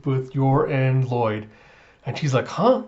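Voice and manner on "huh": high, quick questioning yelp; acted surprise, pitched up; startled huh. Child sees her family in a vision, startled.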